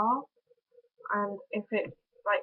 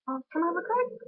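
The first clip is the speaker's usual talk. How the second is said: mock order; 8 semitones higher, faster than usual.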